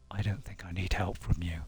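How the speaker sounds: low, whispery dismissive character; Ghost. Acted. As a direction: murmur it low, turning down help. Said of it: "doing a very good impression of Ghost".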